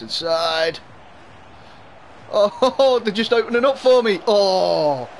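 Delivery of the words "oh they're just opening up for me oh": high, loud, laughing disbelief; excited outcry. Real, not acted. Streamer watches the defence part for him, excited outcry. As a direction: cry out as the chance opens up. Defence opens, chance in game.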